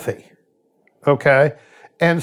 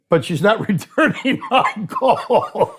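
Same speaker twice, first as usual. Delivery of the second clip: laughing; 6 semitones higher, slower than usual. Retold or imitated. Addict quote, laughing mid-line.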